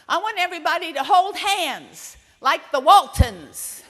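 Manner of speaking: loud, high, slow proclamation; bossy announcement. Retold or imitated. Five-year-old ordering the dinner guests to hold hands, loud proclamation. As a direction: declare it to the whole table, drawn out.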